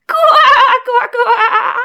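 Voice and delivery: high croaking laugh; laughing frog croak. Acted. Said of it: "засмеялась лягушка".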